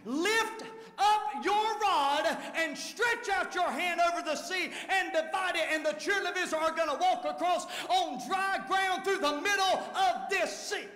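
ringing sermon shout; full-force preaching. Real, not acted. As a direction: preach it out to the congregation. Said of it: "I feel like preaching today".

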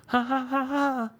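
high "ha" moans turning to laughter; mock orgasm sound. Comedian showing what he does, mock orgasm. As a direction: climax in silly high ha-has, then laugh. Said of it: "That's my orgasm sound".

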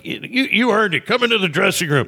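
high-pitched imitation of a rock star. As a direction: pitch it way up, acting out his invitation. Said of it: "[name] was like".

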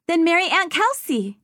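put-on high delighted child; a small girl with a bright idea; little girl voice. A narrator's reading. Child solves crisis.

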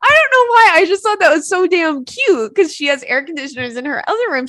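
loud, high, laughing; laughing gush. Real, not acted. Telling listeners how cute he was, loud high laughing.

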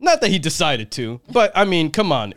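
ordinary talk, nothing marked

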